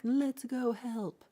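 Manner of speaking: slow, high, loud rallying call; heroic call. Retold or imitated. The fishermen turning back, a slow high heroic call. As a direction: stretch it out like a brave rally.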